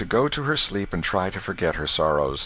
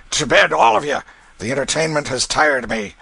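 loud, raised dismissal; commanding; 7 semitones higher, 6 dB louder than usual. Acted. Father sending everyone to bed, loud and commanding. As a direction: bellow the order, then ease slightly.

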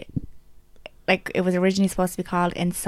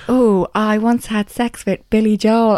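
slow, loud, drawn-out sitcom quote; TV character impression; 3 semitones higher, 6 dB louder, slower than usual. Quoting a favourite character to a friend, hammed-up impression. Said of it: "What does she say?".

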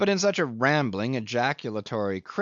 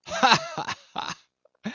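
sudden high cracked cackle; a wild cracked burst of laughter; much slower than usual. A narrator's reading. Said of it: "wild, cracked burst of it".

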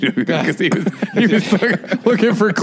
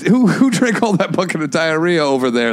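normal talking voice

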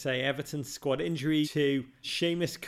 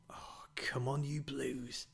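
whispered plea; 9 dB quieter, slower than usual. Real, not acted. Private plea for his team.

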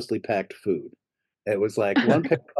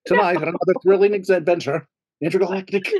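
trailer announcer; 6 semitones higher than usual. Pretend. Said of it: "mock show trailer".

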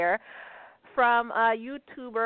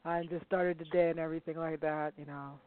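soft, sleepy, lightly mocking impression of a friend who just woke up; 3 semitones lower, 6 dB quieter than usual. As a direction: drop into a drowsy, laid-back mock voice. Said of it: "'Cause that's exactly how you sound".

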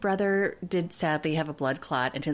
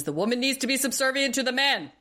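mock macho lecture; 9 semitones higher than usual. Mocking Andre's macho lecture.

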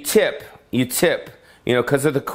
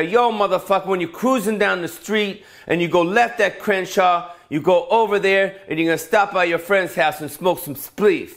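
higher, swaggering street directions; street-talk GPS voice; 6 semitones higher than usual. Retold or imitated. Navigation voice for a brother, loose swagger, higher. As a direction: cruise through the route with swagger. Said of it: "You get the brother in there".

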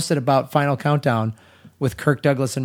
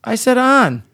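high, loud Chicago accent; 9 semitones higher, 4 dB louder, slower than usual. Real, not acted. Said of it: "it's your Chicago accent".

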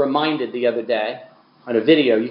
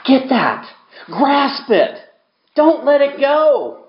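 higher and louder, slow and insistent; fiery exhortation; 12 semitones higher, 4 dB louder, much slower than usual. Acted. Preacher urging brothers and sisters to hold on, preaching hard. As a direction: hammer it home, urging them to hold on.

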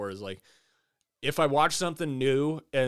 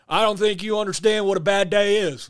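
high, loud Southern menace; Southern accent; 7 semitones higher, 7 dB louder than usual. Retold or imitated. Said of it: "also doing a Southern accent".

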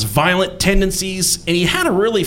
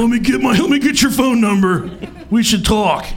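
a touch of a slick guy; lightly voiced smooth talker; 7 semitones higher than usual. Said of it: "he's just like".

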